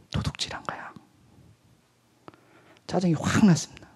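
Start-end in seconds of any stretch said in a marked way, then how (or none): 0.1-0.9 whispered, God's calm judgment; a hushed divine rebuke; quiet verdict. Acted. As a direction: whisper the accusation softly. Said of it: "조용히 한 말씀 하셨어요".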